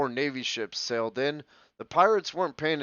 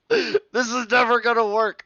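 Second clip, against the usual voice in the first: laughing; 10 semitones higher, 7 dB louder than usual. Real, not acted. Laughing fit while recording.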